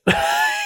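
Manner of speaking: quick squeaky laugh; high amused titter. Real, not acted. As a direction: burst into a high little laugh. Laugh about the sick kid.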